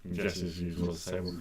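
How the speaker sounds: ordinary talk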